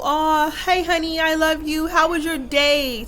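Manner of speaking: slow, gently sing-song mock sweet father; sweet dad. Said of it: "type of dad".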